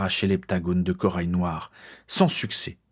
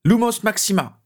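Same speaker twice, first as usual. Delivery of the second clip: high, forceful incantation; urgent high shout of a spell; cried-out spell; 12 semitones higher, 4 dB louder than usual. A narrator's reading. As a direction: cry the spell out, pitch leaping up. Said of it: "il cria".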